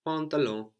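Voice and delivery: "pantalon" with the N barely sounded; half-silent final N. Shown as a demonstration. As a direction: let the last N in "pantalon" melt into a nasal vowel. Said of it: "are half silent, not very strong at all".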